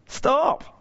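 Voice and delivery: high, rising call to stop; lone high-pitched stop. Retold or imitated. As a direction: cry it out upward. Voicing a plea to stop.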